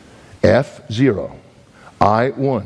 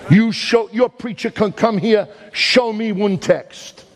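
fiery preaching; 8 semitones higher than usual. Acted. Preacher challenging Sunday keepers, fiery preaching. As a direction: drive it home to the congregation, each phrase hammered.

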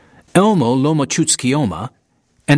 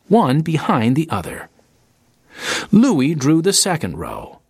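ordinary delivery

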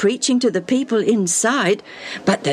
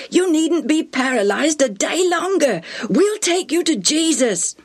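high-pitched, eager urging; very excited; 10 semitones higher than usual. Acted. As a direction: deliver great news to a friend, pitched high. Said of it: "had come in very excited".

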